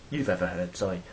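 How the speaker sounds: usual speaking voice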